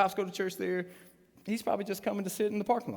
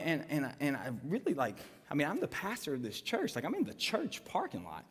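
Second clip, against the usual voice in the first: ordinary talk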